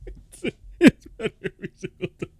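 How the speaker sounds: words squeezed out through laughter; real laughter at a replay; laughing. Real, not acted. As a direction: laugh helplessly while saying it.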